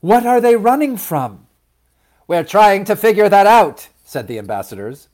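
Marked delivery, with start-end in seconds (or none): 0.0-1.5 loud, high, urgent question; alarmed mother, voice raised; demanding. A narrator's reading. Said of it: "she demanded". 2.3-3.9 loud, high, harried reply; raised, pressured voice; flustered shouting back. A narrator's reading. Ambassadors arguing with Mama.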